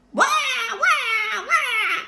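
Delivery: loud, high fake baby cry; baby wailing. Baby fakes crying to call mother, loud wailing. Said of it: "at the top of his voice".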